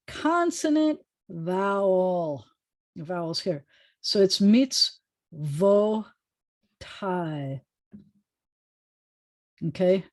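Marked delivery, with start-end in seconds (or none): 4.0-7.6 mitzvotai stretched out very slowly, mits-vo-TAI; very slow pronunciation. Shown as a demonstration. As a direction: draw out every syllable of mitzvotai. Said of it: "that's a tricky one".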